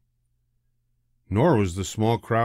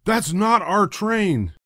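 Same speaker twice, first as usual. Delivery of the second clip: worried father; 10 semitones higher, slower than usual. A narrator's reading.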